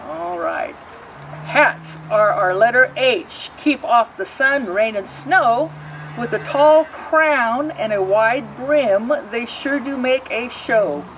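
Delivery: put-on lilting rhyme read aloud to kids; reading to children. A narrator's reading. Librarian reading a picture book to children, lilting. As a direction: read each verse like storytime.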